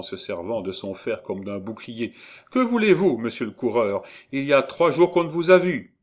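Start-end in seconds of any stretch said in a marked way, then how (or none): 2.5-5.9 high, loud pert rebuke; reproachful young woman. A narrator's reading. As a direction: tease and scold an absent lover. Gervaise holds off her absent lover. (reproche, voix féminine aiguë)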